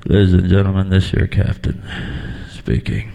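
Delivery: low, mumbled captain's announcement; a hint of a stoned pilot on the intercom. Pilot announcing to passengers, lightly voiced and low. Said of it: "I don't wanna hear".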